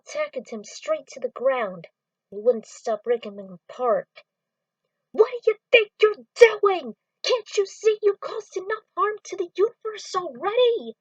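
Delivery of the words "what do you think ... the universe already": much higher and louder indignant yell; outraged shout. Acted. Friend confronts the villain, an outraged shout. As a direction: rage at the villain.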